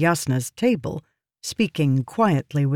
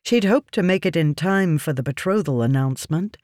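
ordinary delivery, nothing marked

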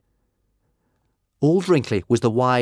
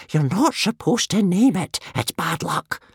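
desperate warning; 11 semitones higher, 3 dB louder than usual. A narrator's reading. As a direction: warn in superstitious alarm. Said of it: "desperately flapping his hands".

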